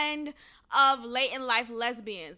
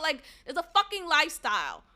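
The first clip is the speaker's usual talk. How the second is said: ordinary talk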